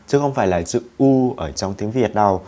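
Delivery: usual speaking voice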